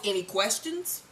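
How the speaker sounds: low, soft menacing drug lord; a hint of a cold kingpin voice; lightly voiced Milan. Recapper voicing Milan calmly quizzing Ghost, low and quiet. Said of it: "asks Ghost".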